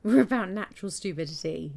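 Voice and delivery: loud jokey proclamation; loud joking. Real, not acted. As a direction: proclaim it with a grin.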